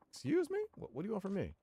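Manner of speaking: high, squeaky, taken-aback voice; mock nervous cafe owner; flustered shop owner. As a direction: play a startled little shopkeeper. Voicing the cafe owner's lines.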